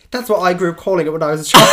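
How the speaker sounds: loud and laughing. Real, not acted. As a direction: say it cracking up. Laughing while quoting.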